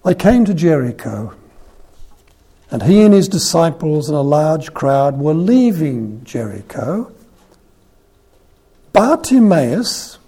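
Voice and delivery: measured, deliberate public reading; scripture reading. A narrator's reading. Preacher reads the Gospel to the congregation, measured and deliberate. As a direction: read it out clearly with weighty pauses.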